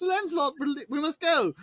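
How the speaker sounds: high falsetto plea; Herbert urging escape. Retold or imitated. Herbert calls to Lancelot from the window, high-pitched. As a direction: call out in a high, fussy voice.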